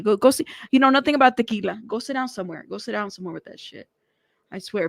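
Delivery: usual speaking voice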